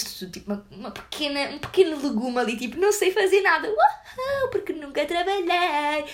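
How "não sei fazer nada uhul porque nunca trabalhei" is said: high, whiny mock voice of a helpless newbie; lightly voiced clueless little courgette; scared little vegetable. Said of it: "uma courgette ali cheia de medos". (voz fininha de legume assustado, a gozar)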